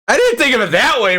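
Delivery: high, fast words through a laugh. Real, not acted. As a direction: laugh while answering the joke. Laughing at innuendo joke.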